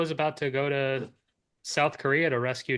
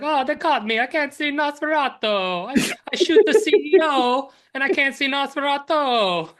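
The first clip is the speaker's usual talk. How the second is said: whiny complaining voice; 10 semitones higher, 4 dB louder than usual. Retold or imitated. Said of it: "He's like".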